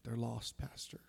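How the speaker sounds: the friend answering the pastor softly; quiet echo. Retold or imitated. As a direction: murmur it back in hushed agreement. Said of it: "And he said".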